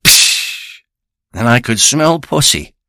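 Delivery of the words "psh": unvoiced psh burst; pop of a seal. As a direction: spit out a quick airy pop. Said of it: "it made that sound".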